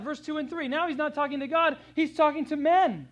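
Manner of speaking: usual speaking voice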